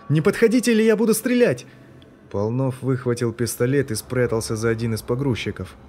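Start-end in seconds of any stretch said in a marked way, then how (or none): 0.1-1.7 high, loud frantic yell; panicked threat. A narrator's reading. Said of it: "заорал вдруг кто-то".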